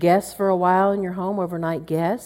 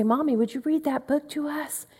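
higher, lightly voiced child asking Mommy; pleading child; 8 semitones higher, 4 dB quieter than usual. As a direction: ask sweetly like a child wanting a story. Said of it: "And so they would say".

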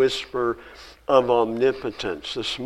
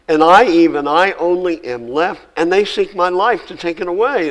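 loud, raised scripture reading; preaching at full force; 4 semitones higher, 7 dB louder than usual. Acted. Preacher reading Elijah's complaint to the congregation, loud and raised. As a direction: proclaim it to the whole church.

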